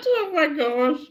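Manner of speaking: high, loud-pitched laughing exclamation. Real, not acted. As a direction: burst out laughing through the words. Bursts out laughing at retold remark.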